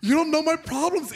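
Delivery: loud, high mock indignation; hurt outburst. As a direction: lash back at the kid, comically wounded. Teacher snapping back at kid.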